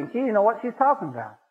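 normal talking voice

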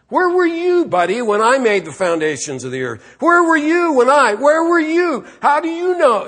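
high, loud, challenging questions; put-down. Acted. Preacher voices God confronting Job, high forceful put-down. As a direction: hammer each question at him as a rebuke. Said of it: "he just puts him down".

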